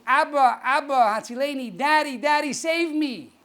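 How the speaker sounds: high, pleading call for rescue; child calling father. Acted. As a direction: call out to daddy like a child in need. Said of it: "child calling out to the father".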